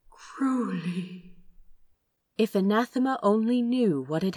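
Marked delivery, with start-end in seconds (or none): 0.1-1.3 voiceless whisper of the name; answering a mouthed question in a whisper. Acted. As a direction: breathe the name back secretly.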